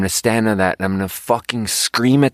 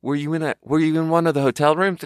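puzzled corporate questioner; 7 semitones higher than usual. Retold or imitated. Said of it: "it's more like".

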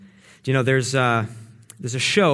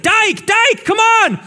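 much higher, louder frantic yell at a frozen officer; retold yell; 8 dB louder, much slower than usual. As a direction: shout his name up high, desperate.

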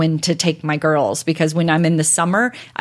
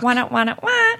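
high, mechanical doll cry sound; crying robot doll; 6 semitones higher than usual. Retold or imitated. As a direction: whine like a tinny toy robot crying. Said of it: "It's a robot".

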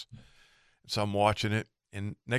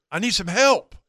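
high-pitched imitated plea; pleading lost boater; 8 semitones higher, 4 dB louder than usual. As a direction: call for help as the lost boater, voice raised in pitch. Lost boater asks for help.

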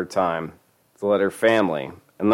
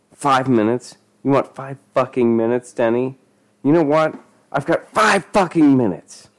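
raised, higher-pitched menacing rant; angry incredulity; 6 semitones higher, 4 dB louder than usual. A narrator's reading. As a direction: throw the plea back angrily. Dealer mocks plea, losing patience.

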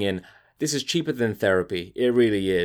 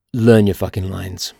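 louder, lower punch; angry command; 4 semitones lower, 5 dB louder than usual. Real, not acted. Host scolding actors, louder. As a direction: bark the advice at actors. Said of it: "I'm so angry".

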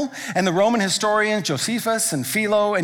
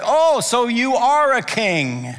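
slow, higher, louder mocking drawl; mocking Pilate; 5 semitones higher, 4 dB louder, much slower than usual. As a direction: sneer down at the prisoner king. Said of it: "And so he's like".

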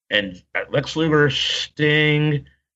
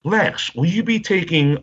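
higher, put-on interviewer tone; mock fan question; 7 semitones higher than usual. Fan putting a question to Luger, higher mock-earnest voice. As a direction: lightly act out a formal question, pitched up. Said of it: "and I said".

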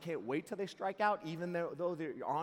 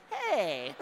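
higher, louder "hey" with a laugh; jokingly indignant; mock protest; 5 semitones higher, 7 dB louder than usual. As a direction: play at being offended by dad's teasing. Mock protest at dad's teasing.

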